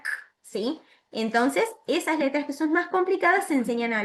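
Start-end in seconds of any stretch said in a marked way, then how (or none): 0.0-0.1 bare "k" release; isolated "k" consonant; C as a K sound. Shown as a demonstration. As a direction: say "k" as a short sound, not the letter name. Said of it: "hay veces que suena".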